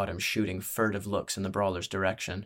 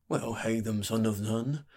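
lightly voiced barkeep, rising at the end; musing barkeep. Barkeep repeating a newcomer's name back, musing. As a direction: weigh up the name as you repeat it.

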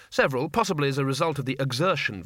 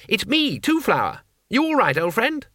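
cheerful call; 9 semitones higher than usual. Acted. Two Flower calling out to the stuck wizard, glad. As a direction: call across the mud, delighted.

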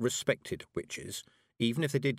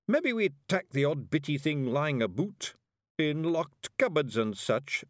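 high Scots simpleton, mildly pleased; happily; 7 semitones higher, slower than usual. A narrator's reading. Daft warrior owns up to pilfering, happily. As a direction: add it with innocent cheer. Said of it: "added Daft Woolly happily".

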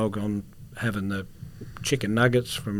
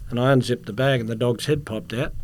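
usual speaking voice

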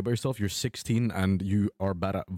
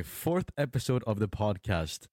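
usual speaking voice

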